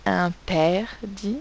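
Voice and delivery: "interdit" split as in, ter, dit; slight gaps inside "interdit" for spelling; syllable by syllable. Shown as a demonstration. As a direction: dictate "interdit" in three separate beats. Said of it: "Pour écrire le mot interdit".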